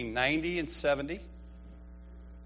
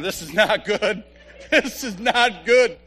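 laughing dismay; 5 semitones higher, 8 dB louder, slower than usual. Retold or imitated. Telling Donna the bad news.

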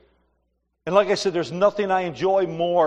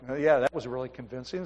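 dry sarcasm; 6 semitones lower, 7 dB quieter than usual. Retold or imitated. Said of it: "And I'm like".

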